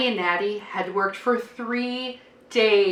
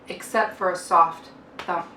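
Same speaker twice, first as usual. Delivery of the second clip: ordinary delivery, nothing marked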